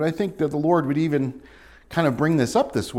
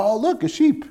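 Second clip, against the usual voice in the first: higher, delighted cooing; charmed; 11 semitones higher than usual. Pretend. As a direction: point it out like a cute sight. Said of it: "You go".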